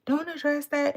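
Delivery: high mock voice of nagging commenters; a hint of prissy commenter; imitating commenters. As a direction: mimic the scolds, lightly. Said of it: "people was like".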